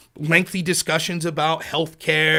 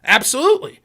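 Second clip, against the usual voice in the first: eager agreement; 9 semitones higher, 8 dB louder than usual. Retold or imitated. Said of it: "the left was like".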